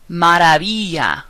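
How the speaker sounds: "Maravilla" as "mah-rah-BEE-ya"; Spanish "Maravilla", soft V and LL as Y. Shown as a demonstration. Teacher modelling V words, soft V in "Maravilla". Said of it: "V sounds more like the letter B".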